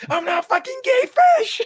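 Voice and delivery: shrill high yell of outrage. Retold or imitated. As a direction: scream it, mad about the joke. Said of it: "He gets super mad".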